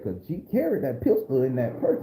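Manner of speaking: normal talking voice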